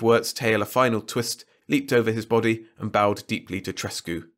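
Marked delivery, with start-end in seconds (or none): none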